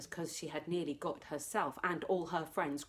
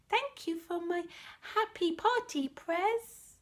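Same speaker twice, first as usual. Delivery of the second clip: put-on higher sweet child voice; little girl; 11 semitones higher than usual. Annabelle hugging him at bedtime, little girl voice. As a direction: thank him like a tiny girl.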